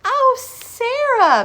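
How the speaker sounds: very high, loud, slow gushing principal. Acted. Principal greeting Sarah at the car, gushing. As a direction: gush all over her. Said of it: "Missus Burton gushed".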